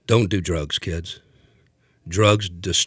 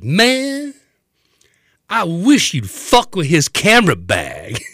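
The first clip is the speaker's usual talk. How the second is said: put-on higher, louder, slow drunken challenge; hammed-up slurred threat; belligerent drunk; 11 semitones higher, 7 dB louder, slower than usual. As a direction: goad the bouncer like a wasted tough guy. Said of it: "He goes".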